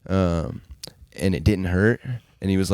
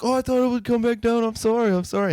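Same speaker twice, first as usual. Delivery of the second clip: high mock-innocent kid voice; sing-song sorry from a little brat; fake apology. Bully kid's fake apology.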